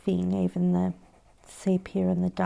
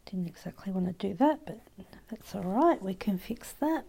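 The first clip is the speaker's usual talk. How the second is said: whispering; 11 dB quieter than usual. Real, not acted. Whispered asides while drawing.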